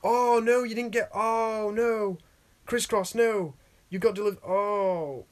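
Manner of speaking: loud, high shocked cry of dismay. Real, not acted. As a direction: react like hearing terrible news. Reacts to viewer's fake figure.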